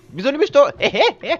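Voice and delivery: high, loud teasing goodbye with a cackle; gleeful mocking farewell; cheeky taunt. Real, not acted. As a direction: call it out high and gloating.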